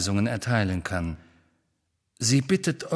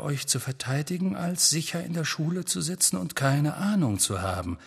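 usual speaking voice

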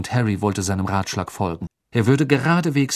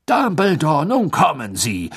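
high, loud, exasperated protest; protest, thoroughly agitated; worked up; 7 semitones higher, 6 dB louder than usual. Acted. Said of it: "sagte Fudge aufgebracht".

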